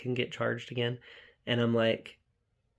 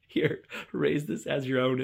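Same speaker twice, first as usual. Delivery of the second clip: slow, hammed-up ceremonious handover; a son grandly handing over an egg; mock solemn offering; 4 semitones higher, 4 dB louder than usual. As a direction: present the egg like a grand gift, slowly. Retelling son's egg handover.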